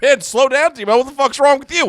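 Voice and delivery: loud, high imitation of an exasperated co-host; mock scolding. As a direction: yell it as a retold telling-off. Acting out co-host scolding him.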